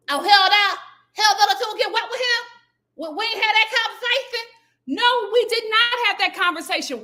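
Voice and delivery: high, loud laughing mock retort; mock sassy reply. Imitating the sister's imagined retort, high and loud. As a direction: fire back, hammed-up. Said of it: "imagined reply, mock voice".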